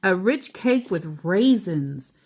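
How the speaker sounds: plain reading voice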